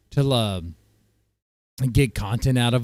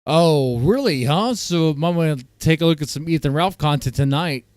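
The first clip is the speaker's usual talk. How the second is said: ordinary talk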